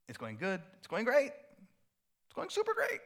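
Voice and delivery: high, rising cheery exclamation; excited. Acted. Voicing churchgoers whose Christmas goes great, mounting excitement.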